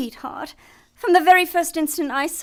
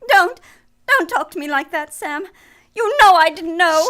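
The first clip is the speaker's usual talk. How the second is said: distraught protest; 8 dB louder than usual. Acted. Accused woman protests the accusation.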